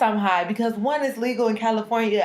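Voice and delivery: usual speaking voice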